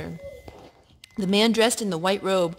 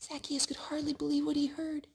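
amazed whisper; 4 semitones higher, 9 dB quieter than usual. A narrator's reading. Reading to a child, a wondering whisper. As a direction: breathe out the disbelief.

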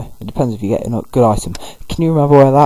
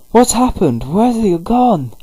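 much higher, slower worried squeak; panicked questions; 10 semitones higher, slower than usual. Retold or imitated. Partner asking where the guildmaster went, high and alarmed. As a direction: fret like a small frantic creature. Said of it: "He's like".

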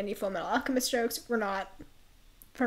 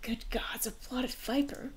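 whispered dismay at a discovery; hushed dread; 4 dB quieter than usual. Acted. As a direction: whisper it, aghast. Reads a character's alarmed reaction.

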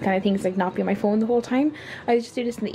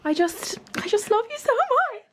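very high-pitched gushing squeal; gushing affection; 13 semitones higher than usual. Real, not acted. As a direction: gush at your friend in a squeaky high voice. Gushing compliments to friend.

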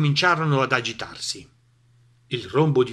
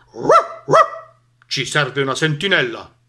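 loud barks then firm dog voice; barking dog; 9 dB louder than usual. A narrator's reading. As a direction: bark the order. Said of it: "abbaiò Cane". (Cane che abbaia un ordine)

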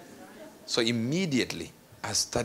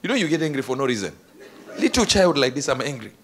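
ordinary delivery

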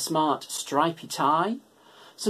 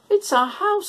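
very high, squeaky little mouse voice; 4 dB louder than usual. A narrator's reading. Mouse telling George her house burned, very high squeak. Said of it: "squeaked the mouse".

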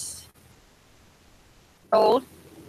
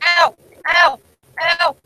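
pained ows; 7 semitones higher, 7 dB louder, slower than usual. Real, not acted. Getting hurt.

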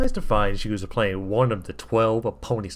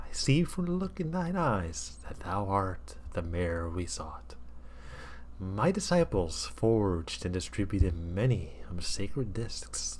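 slow, hushed and softer, sliding into a whisper; solemn whispering prophet; 6 dB quieter than usual. Acted. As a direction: confide a sacred prophecy in a hush. Jesus speaks, partly whispered.

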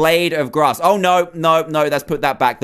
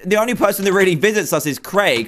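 usual speaking voice

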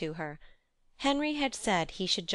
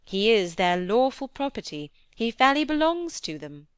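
louder, animated character voice; lively lady in conversation; 8 dB louder than usual. Acted. Lady talking of the clergymen and their brother, animated and emphatic. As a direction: press the point with energy.

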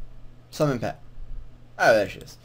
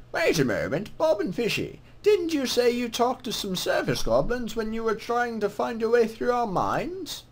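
put-on lilting greeting from an old-world scribe; put-on scribe voice, swooping in pitch; scribe's character voice; 3 semitones higher than usual. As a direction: voice a game scribe saying hello. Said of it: "what voice should I give him".

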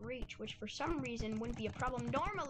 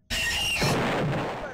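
shrill high shout of heck no; loud yelp; 15 dB louder than usual. Real, not acted. Gamer spotting danger on screen, panicked high yell. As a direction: scream it in panic.

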